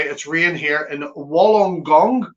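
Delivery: ordinary talk, nothing marked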